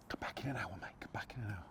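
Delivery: soft unvoiced begging; whispered pleading. Bloke begging bailiffs in a whisper to come back later. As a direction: plead under your breath.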